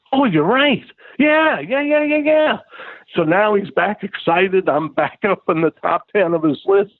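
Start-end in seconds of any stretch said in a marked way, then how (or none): 0.1-2.6 high, rapid eager yeahs; relieved delight. Retold or imitated. Peter cheering up after the insult, high eager delight. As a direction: bounce back into giddy agreement up high.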